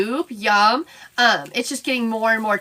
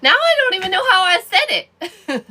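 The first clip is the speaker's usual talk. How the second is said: laughing while asking how she said it; laughing at her own confusion; 6 semitones higher than usual. Real, not acted. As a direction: let the line laugh out.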